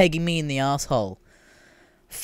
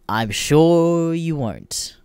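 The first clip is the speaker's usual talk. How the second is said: very slow, drawn-out, dripping sarcasm; sarcastic; 4 semitones higher, 4 dB louder, much slower than usual. Retold or imitated. Answering the website, slow heavy sarcasm. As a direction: say it with total disbelief. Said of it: "And I'm like".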